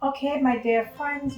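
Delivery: ordinary talk, nothing marked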